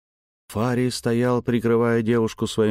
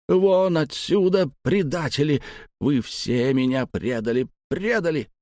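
anguished yell; 5 semitones higher than usual. Acted. The old man drives out the traitors, a much higher anguished yell. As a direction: yell out your betrayal.

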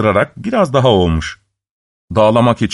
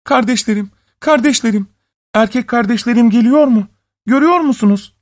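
high, urgent woman's call; 11 semitones higher than usual. A narrator's reading. Wife asking her sisters on the walls, high urgent call. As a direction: call anxiously up to someone, pitched high. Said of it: "surlara doğru bağırmış".

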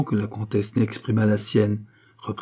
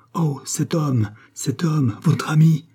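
higher-pitched quoted outcry; exclamation; 6 semitones higher, slower than usual. Acted. Charny quotes Andrée's cry back to her, as an exclamation. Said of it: "en vous écriant".

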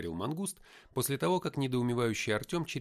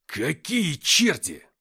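growled; 6 semitones higher, 11 dB louder, much slower than usual. A narrator's reading. Kiriyenko growls at the fighters. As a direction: growl it out in disgust. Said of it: "зарычал Кириенко".

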